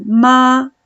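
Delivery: MA held high and level; first tone. Shown as a demonstration. Teacher contrasting tones for student, MA sustained level. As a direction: sustain MA on one straight pitch. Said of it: "es un tono sostenido".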